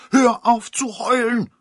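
high, loud angry cry; upset shout. A narrator's reading. The godmother shouts at the wailing mother, high and loud. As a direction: snap at her to stop crying. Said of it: "rief die Patin aufgebracht".

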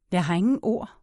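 plain reading voice

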